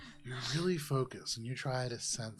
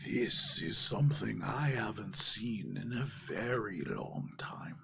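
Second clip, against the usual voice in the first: solemn voice sinking to a low whisper; slow-talking mystic Javaris; 3 semitones lower, slower than usual. Acted. Javaris studies the plate for Valis, solemn hushed mystic. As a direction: recall it with hushed gravity.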